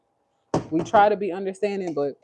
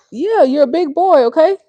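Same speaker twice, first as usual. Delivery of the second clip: encouraging; 8 semitones higher, 8 dB louder than usual. Real, not acted. Soothing a crying toddler.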